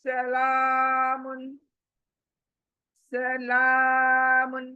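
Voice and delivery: slow, melodic tajweed recitation; Quranic recitation. Shown as a demonstration. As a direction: chant it the way it is recited.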